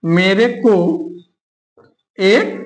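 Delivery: word groups split by long pauses; low, unhurried, piece by piece; slow dictation. Shown as a demonstration. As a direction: dictate it at writing speed. Writing out an example sentence.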